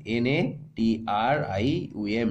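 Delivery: slow letter-by-letter spelling of natrium; slow, clear dictation of the letters. Shown as a demonstration. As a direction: spell N A T R I U M slowly, one letter at a time. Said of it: "natrium বলা হয়".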